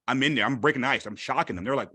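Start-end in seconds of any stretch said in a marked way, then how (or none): none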